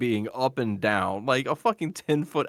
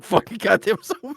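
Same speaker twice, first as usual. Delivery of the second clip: mock brooding; 10 semitones higher, 6 dB louder than usual. Mocking Man Owl's monologue.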